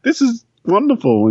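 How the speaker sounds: high, excited Doctor voice. Retold or imitated. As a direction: pitch it way up in wonder. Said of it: "the doctor pulls her on in and says".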